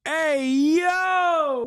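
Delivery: high loud shout of disbelief; shocked outburst. Real, not acted. Streamer shouting in shock at the clip. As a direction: yelp it out in pure shock.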